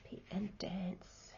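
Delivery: normal talking voice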